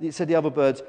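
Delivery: plain reading voice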